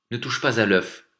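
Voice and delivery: insistent command; pressing voice. A narrator's reading. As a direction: warn him off firmly. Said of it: "dit l'homme d'une voix pressante".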